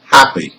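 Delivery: "happy" stressed on HAP, weak ending; first-syllable stress. Shown as a demonstration. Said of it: "the first syllable".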